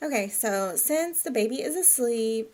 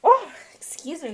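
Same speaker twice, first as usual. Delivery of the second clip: startled after sneezing; 8 semitones higher, 7 dB louder than usual. Real, not acted. Right after a loud sneeze.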